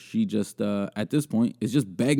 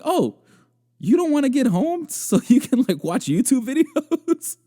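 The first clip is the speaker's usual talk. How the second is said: high, loud mock surprise breaking into laughter; mock-naive, cracking up; 4 dB louder than usual. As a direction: play the naive reply, laughing by the end. Mocks own naive reply, cracking up.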